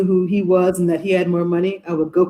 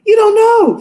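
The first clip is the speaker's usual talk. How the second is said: high, loud mock protest; disbelief; 7 dB louder, slower than usual. Mother protesting to her daughter, high loud disbelief. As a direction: refuse to believe it, up high. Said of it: "I go".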